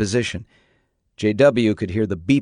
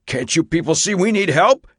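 outraged; 8 semitones higher than usual. Acted. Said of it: "he said, sounding outraged".

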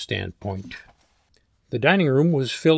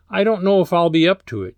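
higher, louder, hurried and uneasy; nervous rush; 6 semitones higher, 5 dB louder, faster than usual. A narrator's reading. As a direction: blurt it out anxiously. Sheila shivers, evades the question.

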